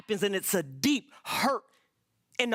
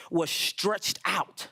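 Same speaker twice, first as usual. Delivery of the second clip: drawn out slow; 4 semitones lower, much slower than usual. Acted. Reading psalm slowly.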